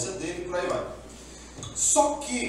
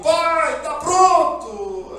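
loud, higher call with a stretched "pronto"; loud happy call; 9 semitones higher, 8 dB louder, much slower than usual. Retold or imitated. Esaú bringing the meat calls to his father, loud. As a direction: call out proudly, slowly. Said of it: "Esaú chegou".

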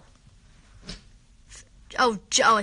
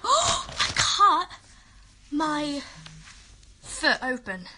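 gasp, then halting word by word; halting shock; 2 semitones higher, much slower than usual. Real, not acted. Girl announcing a cut foot to her viewers, halting. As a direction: break it up with long pauses.

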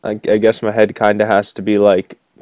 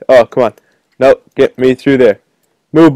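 normal talking voice

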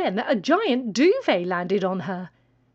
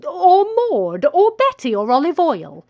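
higher, louder exasperated hen; exasperated shout; 6 semitones higher, 8 dB louder than usual. Acted. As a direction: shout the names in protest. Chicken listing other hens.

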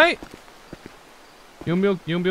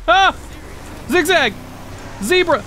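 excited yelling; 8 semitones higher than usual. Real, not acted. Fleeing in the game.

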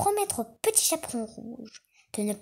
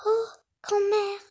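put-on little girl voice; 10 semitones higher than usual. A narrator's reading. Girl speaks to grandmother.

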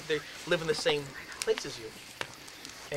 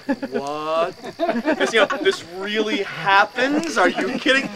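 loud, higher, incredulous; disbelief; 4 semitones higher, 8 dB louder, slower than usual. Retold or imitated. Running from the ghost, loud disbelief. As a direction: sound like you can't believe this is happening.

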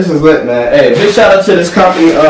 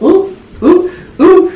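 high delighted oohs; excited squeals; much slower than usual. Real, not acted. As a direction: coo over the fit in a high voice. Admiring his own outfit.

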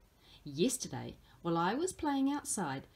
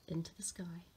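softly whispered to close the image; 4 semitones lower, 10 dB quieter than usual. A narrator's reading. Storyteller to children, fading into a whisper. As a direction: let it drift off in a whisper.

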